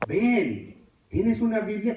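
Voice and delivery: grito agudo y urgente; gritos a la hermana. Retold or imitated. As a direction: pide ayuda a gritos, muy agudo. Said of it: "le empecé a gritar a mi hermana". (súplica gritada desde el sofá)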